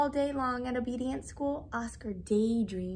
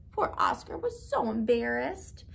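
ordinary delivery

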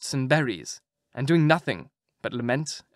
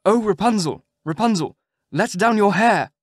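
louder call up to the tower; prince calling up; 6 semitones higher, 6 dB louder than usual. A narrator's reading. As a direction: call up to her window. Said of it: "he went to the tower and cried".

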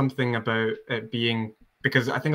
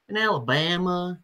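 "In Alabama" in a slow, higher-pitched southern drawl; 4 semitones higher than usual. Shown as a demonstration. Amateur actor showing friends how an Alabama girl talked, southern drawl. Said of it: "quite a delightful southern drawl".

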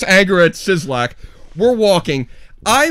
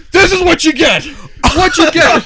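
high, raised-voice mock yell; retold yelling; 9 semitones higher, 4 dB louder than usual. Sislak yells while pouring dead mice on the floor, high mock yell. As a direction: yell it in vengeance, pitched way up. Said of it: "It's just, like, yelling at him".

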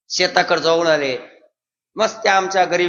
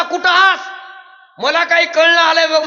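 villager on phone; 10 semitones higher than usual. Retold or imitated.

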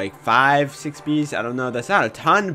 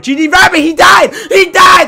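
high, loud, half-crying yell; shocked shout; 13 semitones higher, 8 dB louder than usual. Real, not acted. Streamer watching a rabbit die to his bees, shrieking. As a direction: scream it in shocked disbelief.

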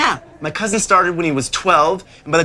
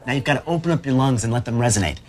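ordinary delivery

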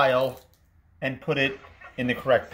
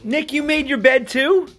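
high, delighted disbelief; amazed; 11 semitones higher, 4 dB louder, slower than usual. Real, not acted. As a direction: act thrilled at the news. Dad amazed son made bed.

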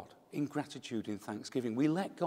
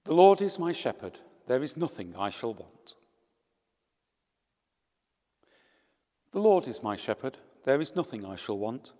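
measured psalm refrain, firm on the Lord; psalm recitation; 4 dB louder than usual. Acted. Priest leading the psalm for the mourners, solemn and measured. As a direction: lead the psalm with solemn rhythm. Psalm led at a funeral Mass.

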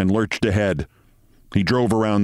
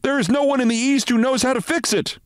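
high, urgent alarm call; shouting intern. A narrator's reading. Intern shouting to the surgeons in alarm. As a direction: cry out that the machine is failing. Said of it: "shouted an intern".

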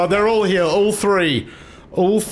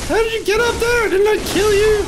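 very high, incredulous squeal; high-pitched startled outcry; shrieked disbelief; 3 dB louder than usual. Real, not acted. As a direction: demand answers in a squeaky panic. Shocked at revived enemy.

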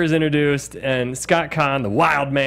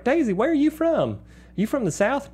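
mock Southern accent; 10 semitones higher than usual. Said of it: "So they're always like".

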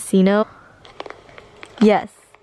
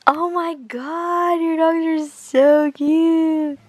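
high, slow cooing over dogs; 6 semitones higher, slower than usual. Real, not acted. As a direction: coo like at a puppy.

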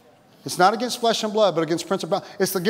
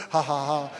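half-hearted laugh; 5 semitones lower, 6 dB quieter than usual. Acted. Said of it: "there's times where I'm going".

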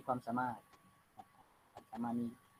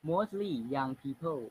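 slow dictation reading; much slower than usual. Shown as a demonstration. Teacher reads text aloud.

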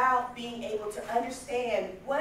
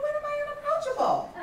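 much higher, taken-aback disbelief; incredulous question; 3 dB louder than usual. Retold or imitated. Gina disbelieving her mother's verdict, incredulous. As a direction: protest it can't be true.

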